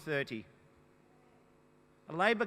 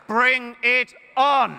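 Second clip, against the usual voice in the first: slow, loud, higher shout; defiant shout; 4 semitones higher, 9 dB louder, much slower than usual. Real, not acted. Leader challenging Mister Abbott to a fight, defiant shout. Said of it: "I've got a three-word slogan for him".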